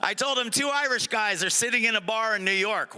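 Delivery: usual speaking voice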